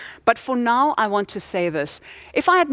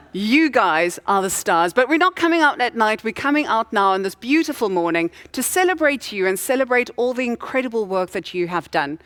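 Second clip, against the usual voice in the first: ordinary talk, nothing marked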